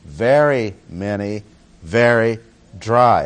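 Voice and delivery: very many, very dry, each very leaned on, dry drawn out slowly; emphasis on very. Shown as a demonstration. Preacher stressing the text to his class, punching very. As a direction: press the words very many and very dry. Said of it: "Notice the emphasis".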